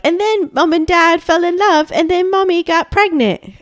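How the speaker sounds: put-on high, sing-song child narrating a play; lightly voiced child; kid voice. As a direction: play the little girl putting on a show. Kids' play voice retold.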